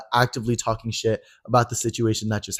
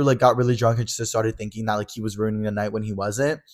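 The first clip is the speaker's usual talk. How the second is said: ordinary talk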